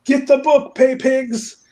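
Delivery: high, loud rallying call; mock urgent command. [name] ordering the pay pigs, mock urgent. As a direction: call out orders to your minions.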